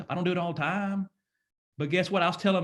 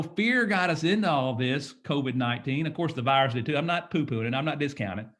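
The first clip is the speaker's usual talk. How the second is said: ordinary delivery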